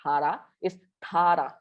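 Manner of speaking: Tara with a tense, strong double d at the start; stronger 따. Shown as a demonstration. Teacher shows the class the strong 따 in Tara. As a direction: hit the double d hard in Tara. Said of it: "Dia kuat sikit".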